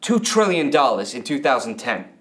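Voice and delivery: ordinary talk, nothing marked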